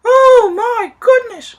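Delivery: very high, loud, slow gasp of shock; shocked cry. Acted. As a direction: cry out at the crash. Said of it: "cried all the people".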